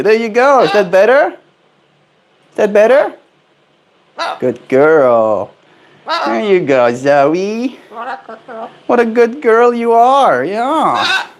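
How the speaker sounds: high cooing pet voice; sing-song coaxing a parrot. Real, not acted. Owner coaxing the parrot Zoe up, high cooing.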